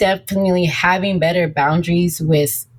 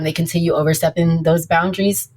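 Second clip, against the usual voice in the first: ordinary delivery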